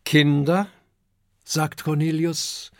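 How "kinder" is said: louder call to the group. A narrator's reading. Teacher dragon calls the children, louder.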